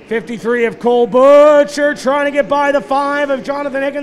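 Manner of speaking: excited live race call; motorsport commentator. Real, not acted. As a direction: call the battle with rising energy.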